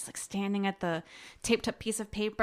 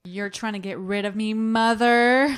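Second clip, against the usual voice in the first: mock indignation; 9 dB louder than usual. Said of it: "Away from us?".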